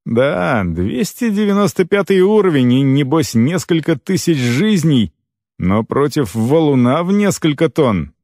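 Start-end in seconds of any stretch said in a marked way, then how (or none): none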